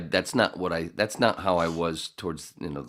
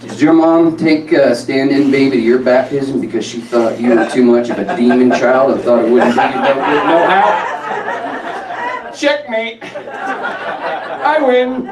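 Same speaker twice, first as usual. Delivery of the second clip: loud, punchy comic delivery; stand-up delivery; 9 semitones higher, 15 dB louder than usual. Acted. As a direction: sell the joke to a crowd. Comedy bit played or performed.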